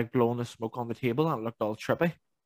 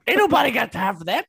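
higher, louder sassy meme line; meme catchphrase; 9 semitones higher, 6 dB louder than usual. Retold or imitated. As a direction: sass it like the famous clip.

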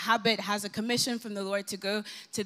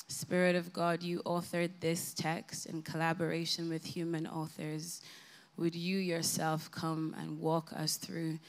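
hushed, softer prayer voice; 2 semitones lower, 5 dB quieter than usual. Acted. Pastor praying over the congregation before the sermon, hushed. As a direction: pray gently over the room.

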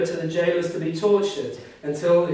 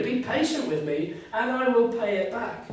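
a hint of a begging servant, pitched up; pleading servant; 9 semitones higher than usual. Reading the servant begging his fellow for time, lightly pleading.

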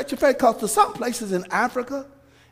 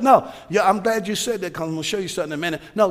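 ordinary delivery

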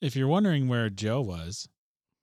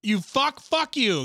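higher, louder street yell; mock tough-guy yell; 11 semitones higher, 5 dB louder, much slower than usual. Friends riffing, hammed-up street yell. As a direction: bark it like a New Yorker. Mock New York yelling.

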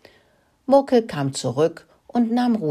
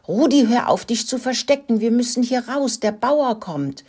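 high, pressing call; urgent calling; 8 semitones higher than usual. A narrator's reading. Young mouse warning hidden friend of farmer, calling urgently. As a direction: call out a warning in a raised pitch. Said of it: "rief Mokelino".

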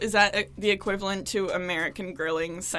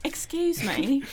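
a higher, lightly voiced British "Excuse me"; British accent; 5 semitones higher, slower than usual. Co-host correcting the host's accent, lightly British. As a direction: show him how the Brit should say it. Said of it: "that was a shitty British accent".

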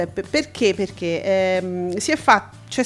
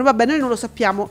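ordinary talk, nothing marked

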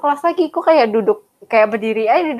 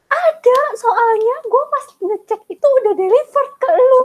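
high, louder whiny friend voice; much higher, pushy imitation with a sob in it; mock shrill insistence; 14 semitones higher, 4 dB louder than usual. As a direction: play her near tears and insisting.

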